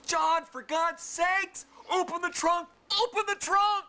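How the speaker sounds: high desperate scream, pitch way up; screaming for help. Retold or imitated. Trapped hand, screaming at John to open. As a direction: yell in pain at the top of your lungs. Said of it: "I start screaming at the top of my lungs".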